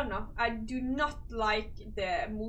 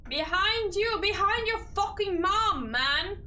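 scared yell; 8 semitones higher, 4 dB louder than usual. Real, not acted. Startled streamer yells at game.

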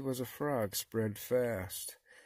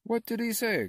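high-pitched, baffled cry; frogs crying out; 12 semitones higher, 5 dB louder than usual. A narrator's reading. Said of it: "cried the frogs".

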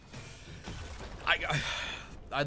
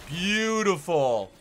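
savoring; 4 semitones higher, 5 dB louder, much slower than usual. Real, not acted. Savoring the play.

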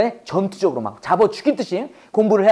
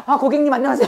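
higher, sugary customer greeting; mom's phone voice; 4 semitones higher, faster than usual. Acted. Mom taking a customer's call, instantly mock-sweet. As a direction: snap into put-on customer-service sweetness. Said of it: "그럼 전화 와서".

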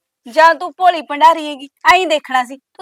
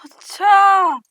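mocking whisper; 5 semitones higher, much slower than usual. Sarcastic reply in a quarrel.

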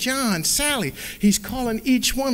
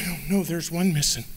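ordinary delivery

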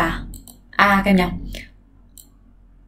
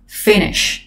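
FIN stressed in finish; weight on fin, light -ish; stress on first syllable of finish. Shown as a demonstration. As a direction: model finish stressed up front. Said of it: "Nó là trọng âm một".

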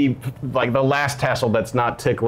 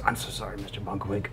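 quiet apology; 4 semitones lower, 11 dB quieter, faster than usual. Acted.

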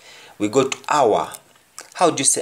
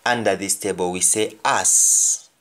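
ordinary talk, nothing marked

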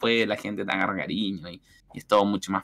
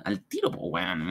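teasing Chilean imitation; 5 semitones lower, 8 dB quieter than usual.

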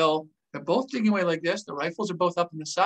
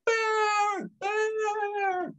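high, drawn-out mock yell; imitated panicked yelling. Soldier fleeing down the trench line, mock panicked yell. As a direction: yell it like someone running away, long and high. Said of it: "running, yelling like".

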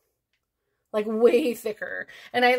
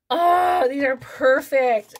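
high, loud oh of delight; thrilled and louder; 4 semitones higher, 7 dB louder, slower than usual. Real, not acted. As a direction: gush over the lashes. Unboxing favourite lashes.

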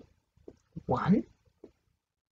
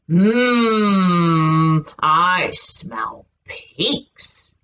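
wolf voice; much slower than usual. Acted. Wolf speaks in story.

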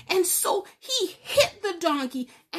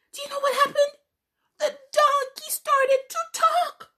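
excited reveal; 12 semitones higher, 4 dB louder than usual. A narrator's reading. Storyteller thrilling the children with the talking donkey, high excited. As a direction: voice leaps way up with wonder.

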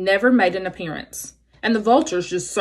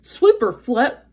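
usual speaking voice

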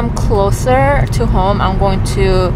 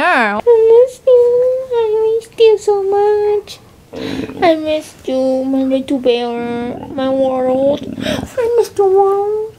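joyful reunion; 11 semitones higher, slower than usual. Real, not acted. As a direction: wail it to the dog tenderly. Reunion with her dog.

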